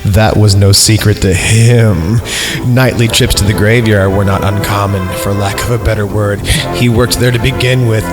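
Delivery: ordinary talk, nothing marked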